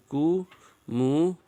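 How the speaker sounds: "कुमुदिनी" drawn out as "कु-मु-दि-नी"; careful slow demo. Shown as a demonstration. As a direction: spell out "कुमुदिनी" slowly for the class. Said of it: "ये बिल्कुल सही है". ("कुमुदिनी" धीरे-धीरे साफ़ बोलकर)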